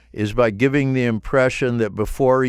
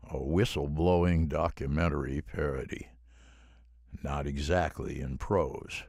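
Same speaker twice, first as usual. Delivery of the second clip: low, slow, hushed voice-over; documentary narration read; 6 semitones lower, 6 dB quieter, slower than usual. Shown as a demonstration. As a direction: lay the title down quietly, slow and lower. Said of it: "just keep it flat".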